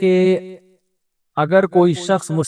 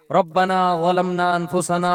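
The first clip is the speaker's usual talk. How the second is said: Quranic recitation; slower than usual. Acted. Quran verse recited in prayer.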